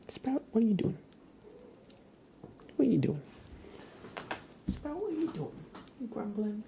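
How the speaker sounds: sing-song high voice for the cat; pet talk to cat. Real, not acted. Cooing at her cat.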